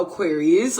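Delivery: ordinary talk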